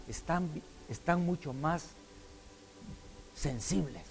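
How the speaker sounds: ordinary talk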